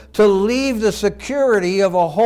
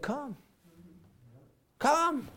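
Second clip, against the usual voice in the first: gentle call; 8 semitones higher, 9 dB quieter than usual. Retold or imitated. Jesus voiced inviting softly.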